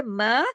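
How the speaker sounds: मा held long and open; demonstrated long मा; lengthened vowel. Shown as a demonstration. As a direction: stretch the vowel of मा. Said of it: "ये मद्दा के हरूफ नजर आ रहे हैं".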